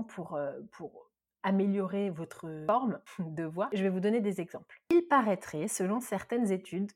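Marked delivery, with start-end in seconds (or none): none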